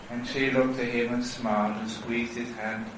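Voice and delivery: usual speaking voice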